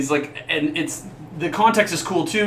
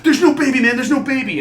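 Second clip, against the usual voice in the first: loud, high, frantic insisting; losing it, trying to convince himself; panicked denial; 10 semitones higher, 5 dB louder than usual. Retold or imitated. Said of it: "the other guy was like".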